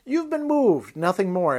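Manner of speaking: pitched far up, bird-demon speaking; high-pitched voice of a demon bird. Acted. Reads the demon bird's words.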